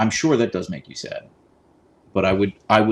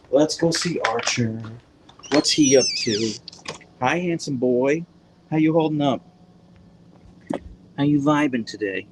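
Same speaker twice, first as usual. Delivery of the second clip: higher doting greeting to the dog; greeting Archer in a higher pet voice; pet talk; 4 semitones higher than usual. Real, not acted. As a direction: coo hello to the dog. Greeting her other dog Archer.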